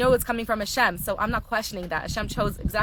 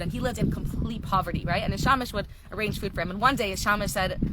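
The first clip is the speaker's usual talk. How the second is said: ordinary talk, nothing marked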